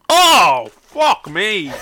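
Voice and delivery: loud disgusted groan. Real, not acted. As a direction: groan loudly at the awful taste. Still reacting to the taste.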